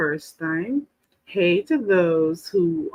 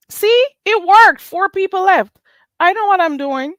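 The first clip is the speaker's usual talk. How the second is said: gleeful gloating; 11 semitones higher, 4 dB louder than usual. Real, not acted. Teasing viewers who left.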